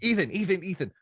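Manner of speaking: excited dad calling his son; retold giddy call to a kid; getting into it. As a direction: burst in high and loud, then ease off. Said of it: "So I, I got into it".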